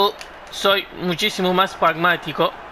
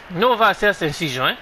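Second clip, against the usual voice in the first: usual speaking voice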